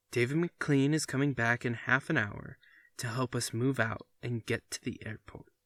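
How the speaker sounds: ordinary delivery, nothing marked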